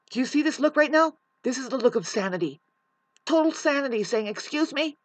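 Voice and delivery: high, loud incredulous rant; exasperated disbelief. Real, not acted. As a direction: pitch it up, indignant and insistent. Ranting in disbelief at neighbor.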